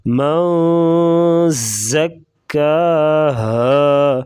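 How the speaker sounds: من hidden in the nose, ز hard in زکاها; slow nasal hum on من; nasal ghunnah. Shown as a demonstration. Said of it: "ناک میں آواز چھپا کر غنہ کریں گے".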